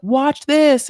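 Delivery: excited retold call. Husband showing off the baby's first steps on video, excited. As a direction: call out proudly as if filming.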